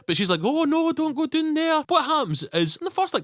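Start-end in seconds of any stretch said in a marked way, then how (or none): 0.4-1.8 high, whiny mock wife voice; nagging Scottish wife. Retelling the wife warning her husband, high and whiny. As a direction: squeal it like a fretting nag. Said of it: "and a nag".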